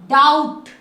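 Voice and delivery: "डाउट" with the b silent, said loud and clear; correct pronunciation. Shown as a demonstration. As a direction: say "डाउट" firmly, no b sound. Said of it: "डक्ट नहीं बोलोगे".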